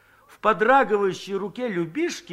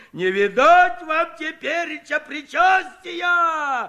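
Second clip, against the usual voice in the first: much higher, slow, shouted fake terror; mock horror; 10 semitones higher, 4 dB louder, slower than usual. Kuzhenkov teases the women with pretend horror, hammed-up shout. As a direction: ham up the dread for a joke. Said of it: "В притворном ужасе закричал Куженков".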